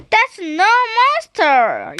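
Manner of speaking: high, loud correction; acted cross squirrel yell. A narrator's reading. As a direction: yell it down at the dragon. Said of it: "yelled the squirrel".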